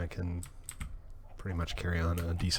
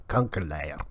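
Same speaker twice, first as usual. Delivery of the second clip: Kankerleier in a lower Amsterdam working class Jordan accent; 4 semitones lower than usual. Shown as a demonstration. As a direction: spit out Kankerleier like an Amsterdam street local. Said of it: "You have to do it with the Jordan accent".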